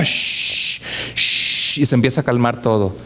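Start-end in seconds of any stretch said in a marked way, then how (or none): 0.0-1.8 whispered shh to still the waves; hushing. Acted. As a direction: hush the sea like a child. Jesus calming the storm.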